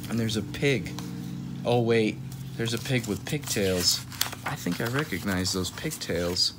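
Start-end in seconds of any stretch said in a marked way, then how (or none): none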